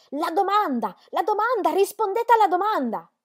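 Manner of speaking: very high, loud insistent cries; shouted demand. A narrator's reading. Two girls holding together against a crowd, shouting their demand. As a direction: yell it like a defiant chant. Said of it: "continuarono a stare unite e a gridare".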